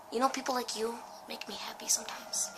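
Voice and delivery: soft coaxing whisper; nervous whispered flattery. Real, not acted. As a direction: whisper sweetly to calm the monster. Sweet-talking the monster.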